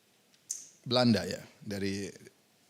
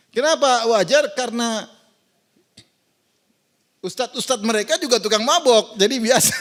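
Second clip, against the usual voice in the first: louder, driving the mockery home; raised preaching voice; 6 semitones higher, 5 dB louder than usual. Real, not acted. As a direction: push it out to the whole hall. Mocking their clergy, loud. (lebih keras, mengejek dengan lantang)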